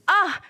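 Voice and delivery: a sharp high yelp of pain; startled cry of pain after a slap. Acted. Sister slapped cries out.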